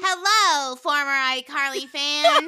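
mock-grand puppet emcee, drawn out; puppet show host. Acting out the puppet master's parody.

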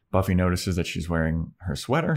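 ordinary delivery

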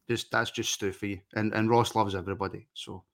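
ordinary talk

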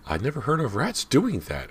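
high-pitched fretting mother; fretful. A narrator's reading. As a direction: say it with worried disbelief. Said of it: "shaking her head fretfully".

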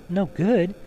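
a touch of fright; frightened. A narrator's reading. Lucy jumps up at the fawn's words, frightened. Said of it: "jumping up and feeling rather frightened".

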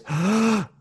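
sharp in-breath before speaking; mock gasp. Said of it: "Alex goes".

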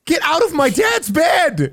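slow, high angry shout. Acted. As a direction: yell them out of the bed. NPC yells at intruder.

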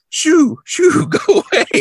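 high, slow dismissive shoo. Retold or imitated. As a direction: shoo him away in a high voice. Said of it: "she flicks her hand at him".

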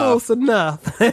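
laughing through the words, high and loud; laughing echo. Pretend. Friend echoing the pizza guy joke, laughing hard. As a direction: crack up while repeating it.

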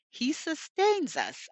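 normal talking voice

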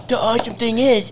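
a guy giving a happy product review; Dave. Acted. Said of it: "Dave, answer me one question".

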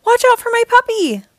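high, loud warning yell; shouting a warning. A narrator's reading. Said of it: "I yelled".

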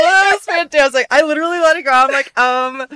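loud, laughing excitement; overjoyed after a spit take; excited. Real, not acted.

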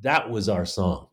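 ordinary talk, nothing marked

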